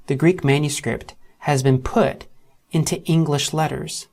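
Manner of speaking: normal talking voice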